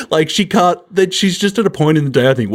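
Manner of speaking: ordinary talk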